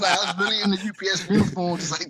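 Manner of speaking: talking through laughter; loud, laughing it off. Real, not acted. Laughs off the UPS joke.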